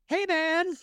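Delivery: high, careful hey-man voice; nervous nice-guy voice telling a stranger to back off; meek polite confrontation. Retold or imitated. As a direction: ask a knife guy to leave politely. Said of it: "That's me".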